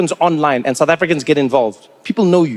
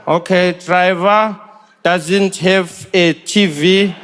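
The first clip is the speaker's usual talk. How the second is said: each word dragged out slowly as if writing it down; slow dictation; much slower than usual. Retold or imitated. Official dictating the report to Trevor, very slow. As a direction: spell it out slowly like a bored official.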